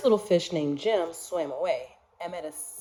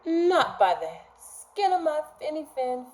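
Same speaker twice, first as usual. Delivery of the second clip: smart little fish; 7 semitones higher than usual. Acted. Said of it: "the smart fish replied".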